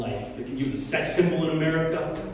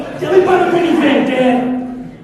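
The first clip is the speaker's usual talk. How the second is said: put-on pushy teen son; 9 semitones higher, 10 dB louder than usual. Son asking to borrow car.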